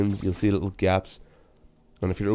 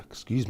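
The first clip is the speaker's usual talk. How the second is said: sudden cough; 8 dB quieter than usual. Real, not acted. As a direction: cough hard mid-word. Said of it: "Got a wee bit too excited there".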